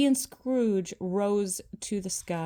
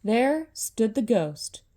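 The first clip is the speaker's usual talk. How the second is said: usual speaking voice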